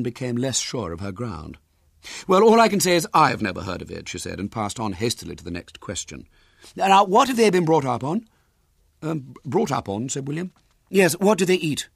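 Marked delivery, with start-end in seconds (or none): 2.3-4.1 high, loud, prim busybody voice; a bossy lady interrogating the boy; lifted, carrying voice of a nosy woman; officious lady. Acted. Woman unsure but bossy. 6.7-11.9 high, loud, prim busybody voice; a bossy lady interrogating the boy; lifted, carrying voice of a nosy woman; officious lady. Acted. Woman questioning William.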